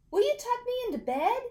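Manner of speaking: high, quick innocent plea; butter them up sweetly; sweet little ask. A narrator's reading. Boy sweet-talking his parents, high and quick.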